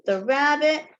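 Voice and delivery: ordinary delivery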